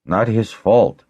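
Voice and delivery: ordinary delivery, nothing marked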